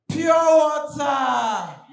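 slow, loud drawn-out hawker cry; street hawker call. Retold or imitated. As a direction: sell it slowly across traffic. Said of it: "You hear something like".

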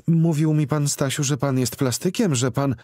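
flustered blonde lady. A narrator's reading. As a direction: falter as you say it.

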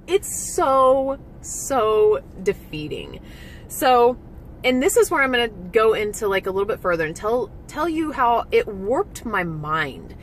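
ordinary delivery

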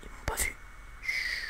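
hushed whisper while hiding; whispered from a hiding spot. Pretend. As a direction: breathe it so no one hears. Hiding from pursuers.

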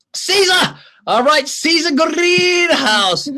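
high, loud, stretched-out name; excited call-out. Real, not acted. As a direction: hail the fan's name with delight. Calling out fan name.

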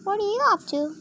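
high, soft pretend voice; girlfriend figure. Toy asking her boyfriend, high soft voice. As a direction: ask it high and softly.

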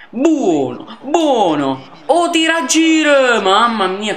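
rising yell as the shot curls; a Lele Adani burst of excitement on the curler; excited pundit. Acted. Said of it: "parlerò come Adani".

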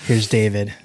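lightly imitated radio host, slow; slow dedication-show announcer impression; old call-in show host. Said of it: "where they used to talk like this".

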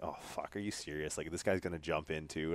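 hushed dread. Retold or imitated. Bystander's worried inner thought.

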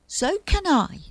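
mildly cross, high-pitched retort. A narrator's reading. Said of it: "said Rufus crossly".

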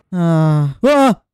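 loud high scream; rising high shriek; startled scream. Real, not acted. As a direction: yelp at the sudden scare. Jump scare scream.

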